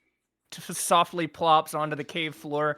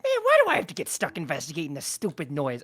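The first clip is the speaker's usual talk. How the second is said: goblin voice. Acted.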